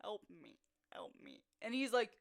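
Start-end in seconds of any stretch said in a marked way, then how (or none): 0.0-0.6 tiny, barely audible plea; mouthing. Retold or imitated. Hurt player secretly begging teammate, mouthed plea. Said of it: "I'm literally mouthing". 0.9-1.4 faintest breath of a plea; nearly silent, just shaping the words; mouthing. Retold or imitated. Hurt player secretly begging teammate, mouthed plea. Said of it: "I'm literally mouthing".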